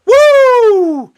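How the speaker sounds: high, loud drawn-out whoop; Ric Flair "woo". Acted. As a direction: let out a long, piercing wrestler's "woo!". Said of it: "As Ric Flair would say".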